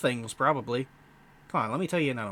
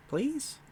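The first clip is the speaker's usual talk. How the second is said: PLEASE high with a rising end; questioning repeat; 11 semitones higher than usual. Acted. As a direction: lift PLEASE into a tentative question.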